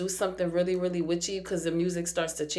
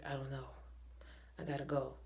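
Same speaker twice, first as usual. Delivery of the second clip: much softer, lower dazed mumble; dazed trance mumble; 7 semitones lower, 16 dB quieter, faster than usual. Retold or imitated. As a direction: sound hypnotized and empty. Entranced man voiced dazed.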